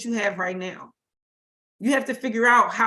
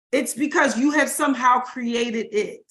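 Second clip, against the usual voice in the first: ordinary talk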